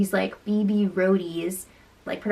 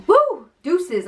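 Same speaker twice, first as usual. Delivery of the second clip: high, playful whoop into a goodbye; breezy mock farewell; 7 semitones higher than usual. Contestant bowing out once she isn't first, breezy. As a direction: toss it off as a cheery sign-off. Retelling Candy's breezy exit.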